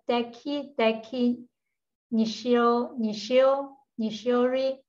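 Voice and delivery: normal talking voice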